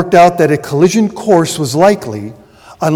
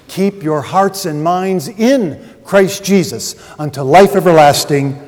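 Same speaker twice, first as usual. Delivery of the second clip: usual speaking voice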